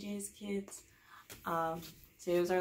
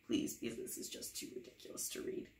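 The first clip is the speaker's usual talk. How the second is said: whispered, lower, pleading; exhausted whispered plea; mock despair; 8 semitones lower, 8 dB quieter than usual. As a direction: beg under your breath, worn out. Begging reader.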